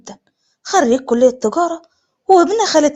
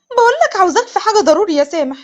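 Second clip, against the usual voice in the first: higher-pitched, urgent pleading; exasperated insistence; 6 semitones higher than usual. Acted. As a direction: press a reluctant boyfriend urgently. تلح عليه بعد رفضه. (بإلحاح وضيق)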